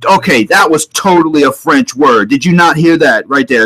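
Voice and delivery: ordinary talk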